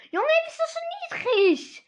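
high, loud disbelieving yelp; indignant outcry. Real, not acted. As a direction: shriek in outrage at the bad luck. Outraged at low card draw.

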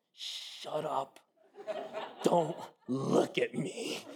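slow, strained, menacing imitation of a wife; labouring wife's warning. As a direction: draw each word out as a low threat. Wife in labour snapping, acted out.

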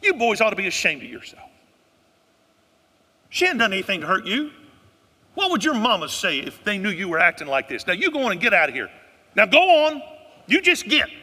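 loud, high scolding with the stress on you; a Southern mother laying down the law; Southern mama scolding. Retold or imitated. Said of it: "And she said".

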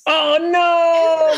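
high shocked cry; surprised shout. Real, not acted. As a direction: blurt it out in shock. Host reacts to being wrong.

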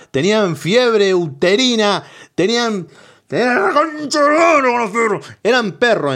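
climbing from sharp to a high furious yell; building rant. Acted. Ranting to the audience about dogs of old, yelling.

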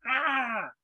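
high, drawn-out "aah" of a creature; acted wounded cry. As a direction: cry out as the stabbed monster. Voices a wounded Kenku's cry.

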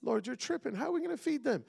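high, rising exasperated disbelief; panicked disciple. Retold or imitated. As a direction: sell it like a disciple who thinks Jesus is tripping. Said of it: "I love what one of the disciples says".

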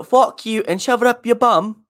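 high mock-English insult; English accent. Shown as a demonstration. Comparing bum with ass, high mock-English accent. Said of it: "English thing to say".

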